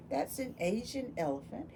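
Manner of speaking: ordinary talk, nothing marked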